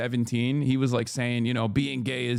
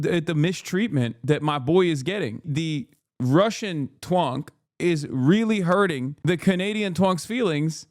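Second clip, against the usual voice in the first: normal talking voice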